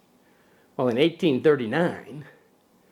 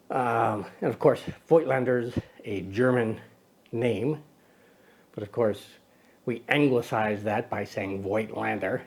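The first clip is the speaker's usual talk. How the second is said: usual speaking voice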